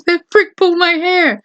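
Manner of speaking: a hint of mocking her complaint; mimicking the girl. Friend quoting the bullied girl, lightly mocking whine. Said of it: "She goes".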